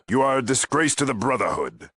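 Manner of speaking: high, quick, scornful condemnation; angry rebuke. Acted. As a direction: denounce them in indignation. Paladin berates the visitor.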